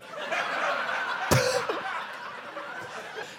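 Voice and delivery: wheezy falsetto laugh; high squealing laugh. Real, not acted. Laughing at own bit.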